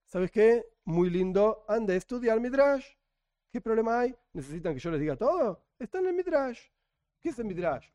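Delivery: ordinary talk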